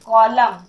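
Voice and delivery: "कॉलम" said with no n at the end; silent n. Shown as a demonstration. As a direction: say "कॉलम" ending on the m, n left silent. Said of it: "एन का sound यहाँ भी नहीं हो रहा है". ("कॉलम" में अंत का न मौन)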